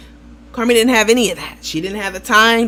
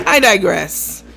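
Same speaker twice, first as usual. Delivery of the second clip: ordinary delivery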